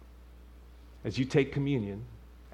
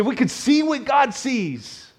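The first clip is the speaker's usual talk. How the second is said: preacher's crescendo; rising fervor; 6 semitones higher, 8 dB louder than usual. Real, not acted. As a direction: voice climbs high and loud on the repeat. Preacher building to a high climax.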